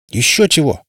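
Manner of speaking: flaring up indignantly; indignant outburst. A narrator's reading. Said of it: "взвился монстробой".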